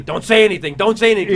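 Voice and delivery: loud, urgent self-warning; inner voice screaming. Retold or imitated. Panicked fan warning himself backstage, urgently loud. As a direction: drum the warning in forcefully, half screamed.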